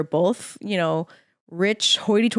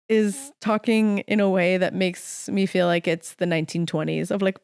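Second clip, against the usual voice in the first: usual speaking voice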